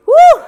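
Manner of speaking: high loud whoop of effort; cheer. Real, not acted. Fitness coach cheering after a tough set.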